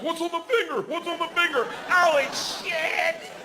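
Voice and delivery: high frantic dog; eager dog voice. Pretend. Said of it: "the dog comes right back like".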